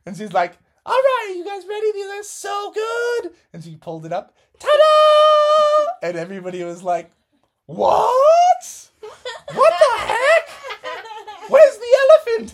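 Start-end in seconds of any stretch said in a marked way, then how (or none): 0.9-3.4 high, squeaky cheerful mom voice; giddy team mom. Acted. Missus Frogerson cheering the boys on, high and giddy. 4.6-6.1 very high, loud ta-da; big reveal. Acted. Team mom unveiling the shirts, triumphant. 7.6-12.5 high, loud confused cries, half laughing; baffled kids. Acted. Boys shocked at the shirt design, baffled.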